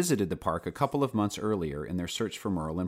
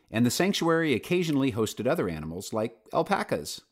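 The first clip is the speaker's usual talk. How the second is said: ordinary delivery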